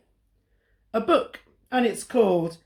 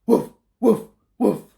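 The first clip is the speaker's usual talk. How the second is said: louder barking woof; dog bark; 3 semitones higher, 5 dB louder than usual. Acted. Dog answering Olive, barking. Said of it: "a dog saying".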